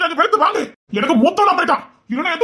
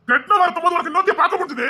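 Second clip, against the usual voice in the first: usual speaking voice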